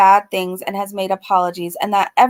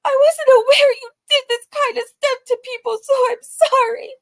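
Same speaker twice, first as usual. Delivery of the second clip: hammed-up whiny apology, much higher; mock crying; 13 semitones higher than usual. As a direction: whimper the apology. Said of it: "She said".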